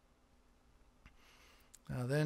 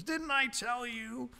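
exasperated Jonah; 8 semitones higher, slower than usual. Acted. Jonah's rhetorical question to God.

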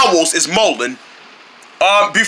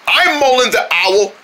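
ordinary delivery

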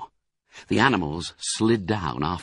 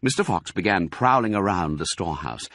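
ordinary delivery, nothing marked

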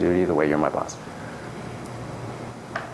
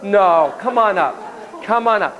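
eager coaxing; 8 semitones higher, slower than usual. Real, not acted. Coaxing volunteer, voice raised.

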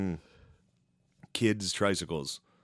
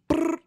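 high, louder brr ad-lib; squealed brr; 5 dB louder than usual. Retold or imitated. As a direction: squeal it like a rapper. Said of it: "starts making the noise".

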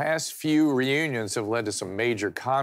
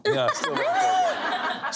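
laughing; much slower than usual. Real, not acted. Host laughs at shy guest.

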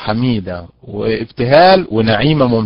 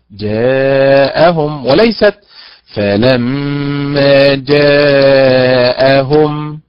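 very slow جاءهم, stress moved onto the هم ending; teacher showing the wrong stress; much slower than usual. Shown as a demonstration. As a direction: stretch جاءهم with the misplaced stress. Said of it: "على حركة الهمزة".

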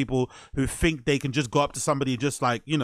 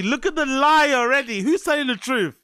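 loud, high-pitched exclaiming at a lie; incredulous and raised well above usual; exasperated outcry; 8 semitones higher, 4 dB louder than usual. Real, not acted. Reacting to a couple's lie.

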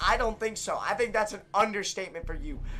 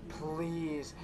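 soft, lower desperate begging; quiet pleading; 7 semitones lower, 11 dB quieter than usual. Real, not acted. Gamer quietly begging teammates to listen to Cyan, desperate. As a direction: beg under your breath.

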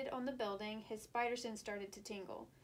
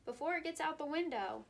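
shouted; 6 semitones higher, 4 dB louder than usual. A narrator's reading. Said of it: "shouted Spidey".

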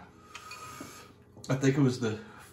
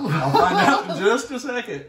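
higher and louder, laughing; 8 semitones higher, 6 dB louder than usual. Real, not acted. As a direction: laugh through the worry. May have wiped chili in eye.

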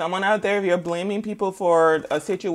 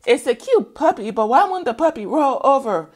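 higher, louder puzzled voice; puzzled quote; 8 semitones higher, 7 dB louder than usual. Retold or imitated. Someone puzzled by a stubborn puppy, voiced higher. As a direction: puzzle over the puppy, pitched up. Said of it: "Said, uh".

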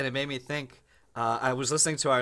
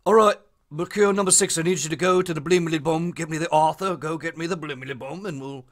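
mock Brummie; 2 semitones higher, 4 dB louder than usual. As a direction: parody Peaky Blinders boss in Brummie. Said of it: "The Peaky Blinders always does".

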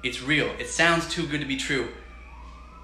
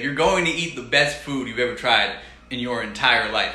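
ordinary delivery

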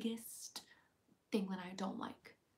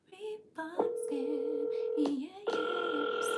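mock scammer voice; 6 semitones higher, 6 dB louder, much slower than usual. Scammer impression, slow.

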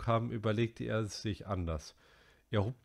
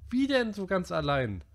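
high, loud indignant protest; indignant cry; 10 semitones higher, 6 dB louder than usual. A narrator's reading. Piggy protesting to Ralph, loud and indignant. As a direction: cry out in outrage. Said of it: "rief Piggy entrüstet".

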